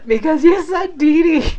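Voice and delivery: high squeal through real laughter; words collapsing into laughter; can't stop laughing. Real, not acted. Replying to commenters who said Dee Dee, helplessly laughing. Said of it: "I can't stop laughing".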